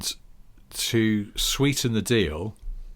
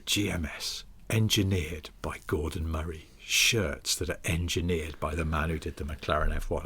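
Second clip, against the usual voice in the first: low, softer ad slogan read; advert tagline voice; 5 semitones lower, 5 dB quieter than usual. Acted. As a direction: sell it like a hushed, deep advert. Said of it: "'cause you could go".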